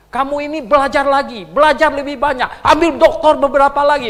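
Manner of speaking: loud, high scornful taunt; mocking angry critic. Angry critic taunting the preacher online, loud mockery. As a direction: jeer at the preacher's learning.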